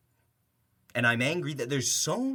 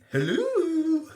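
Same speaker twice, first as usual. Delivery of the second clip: much higher phone-answering voice; call center voice; 12 semitones higher, 3 dB louder than usual. Acted. Call center agent answering Burger, high chirpy hello. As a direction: chirp it like a bored operator.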